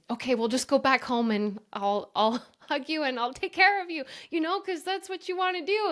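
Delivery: high, cooing comfort with a laugh; soothing mom. Retold or imitated. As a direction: coo to her like a mother giving in. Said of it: "my instinct as her mom is to".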